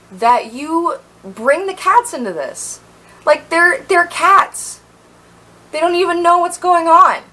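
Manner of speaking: retold angry telling-off; indignant rant. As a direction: let the outrage climb. Angry speech to roommates about cats.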